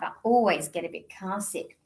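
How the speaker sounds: ordinary delivery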